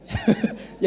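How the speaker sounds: short squeaky laugh; a burst of high giggling. Real, not acted. Laughs at own confession.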